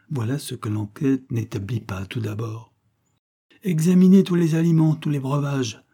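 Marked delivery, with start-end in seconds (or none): 3.6-5.8 loud, higher order that fades at the end; commanding order. A narrator's reading. Widow ordering everyone to test the food, loud and commanding. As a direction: bark out the command, then ease off. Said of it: "ordonna l'inconsolable veuve".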